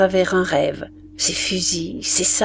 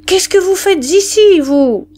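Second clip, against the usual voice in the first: high, loud challenge; indignant shout; 10 semitones higher, 5 dB louder than usual. A narrator's reading. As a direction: shout it at someone who has no business being there. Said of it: "cria la vivandière".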